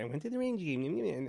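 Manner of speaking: imitating a child murmuring while writing; murmured diary writing. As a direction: say it softly to yourself, as if writing. Mimics daughter writing in her diary.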